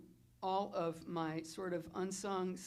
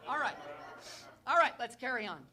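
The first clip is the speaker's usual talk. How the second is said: loud, higher burst to shake off the tears; pulling herself together; 6 semitones higher, 9 dB louder, faster than usual. Real, not acted. Chief steadying herself before her staff, a loud brisk reset. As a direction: brush the emotion off and push on. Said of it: "I'm gonna try to do this without crying".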